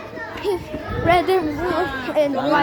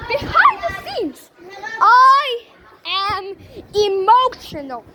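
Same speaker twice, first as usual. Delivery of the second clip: dramatic confession; 4 semitones higher than usual. Real, not acted. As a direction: shout the reveal for drama. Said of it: "here's the truth".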